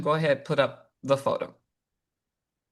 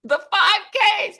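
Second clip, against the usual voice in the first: high slow reveal; 4 dB louder, slower than usual. Pretend. Punchline reveal.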